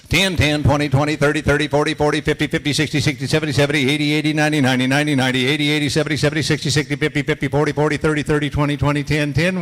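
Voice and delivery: loud rhythmic count, each number said twice; number scale drill. Shown as a demonstration. Auctioneer leading students through number scales, loud and rhythmic. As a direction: bark the numbers up and down like a bid call. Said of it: "number scales".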